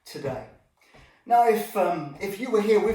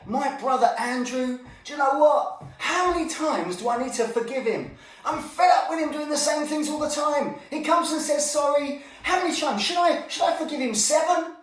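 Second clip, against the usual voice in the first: fed up; 7 semitones higher, 3 dB louder than usual. Retold or imitated. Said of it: "Peter came to Jesus and said".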